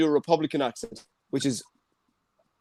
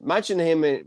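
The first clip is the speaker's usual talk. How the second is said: usual speaking voice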